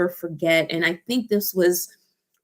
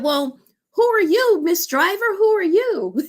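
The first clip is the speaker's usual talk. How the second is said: very high, eager questions; thrilled passengers; excited demand; 13 semitones higher, 3 dB louder than usual. Retold or imitated. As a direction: press the driver to confess. Ladies demand to know.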